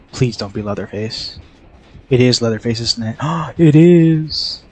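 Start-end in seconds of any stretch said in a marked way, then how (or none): 3.2-4.6 gasp, then a much higher panicked cry; real fright as the killer heads her way. Real, not acted. Streamer spots Leatherface coming and cries out in panic. Killer spotted in horror game.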